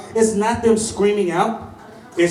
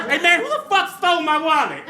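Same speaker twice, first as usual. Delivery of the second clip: mock innocent; 8 semitones higher than usual. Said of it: "I was just like".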